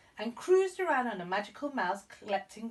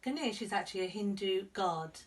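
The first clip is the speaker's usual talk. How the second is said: plain reading voice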